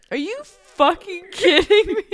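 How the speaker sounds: hammed-up disbelief; mock outrage. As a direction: imitate an exasperated outburst, amused. Retelling sister's outburst at her.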